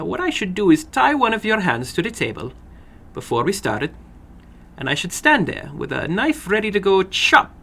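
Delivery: little man calmly explaining the chopping, a lightly voiced odd figure; odd little foreign man. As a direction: calmly explain how you'd chop the finger off.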